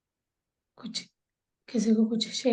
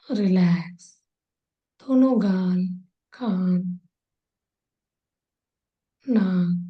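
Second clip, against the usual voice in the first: unhurried body-scan relaxation, long pauses between phrases; guided meditation; slower than usual. Real, not acted. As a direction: guide them into calm, drawing out the soothing words. (ठहर-ठहर कर, शांत भाव से निर्देशित ध्यान कराते हुए)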